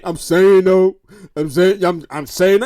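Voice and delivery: low mocking imitation; deep, put-on naysayer voice; mimicking a refusal. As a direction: drop way down to mimic someone refusing. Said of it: "and stop saying".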